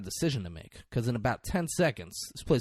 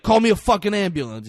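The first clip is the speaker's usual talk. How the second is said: louder, higher and rushed; imitated urgent demand; 7 semitones higher, 7 dB louder, faster than usual. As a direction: act out a wounded man demanding help. Wounded man demands help.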